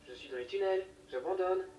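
loud frustrated yell; shouting. Retold or imitated. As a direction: shout it down the tunnel. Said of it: "j'ai crié".

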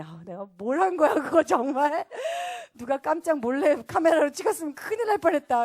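loud, high mock mortification; embarrassed. Woman the morning after her shouting, mortified. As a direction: exclaim in laughing embarrassment at yourself. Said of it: "너무 창피하고".

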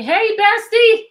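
loud, high, drawn-out hello; light up greeting a friend; excited greeting. Real, not acted. Teacher greeting a student joining, loud and delighted.

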